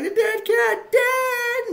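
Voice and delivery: very high, loud mock scream; hammed-up scream. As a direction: scream for help like a panicked girl in a play. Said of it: "Megan screams".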